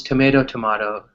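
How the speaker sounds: tomato as to-MAY-do, then to-MAH-to; both pronunciations. Shown as a demonstration. Teacher showing a student the tomato phrase, two vowels. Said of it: "No problems".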